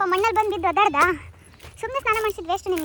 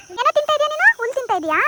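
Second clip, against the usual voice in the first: high, loud teasing question to the dog; high playful pet voice; pet talk; 7 semitones higher, 7 dB louder than usual. Real, not acted. Asking dog what it eats.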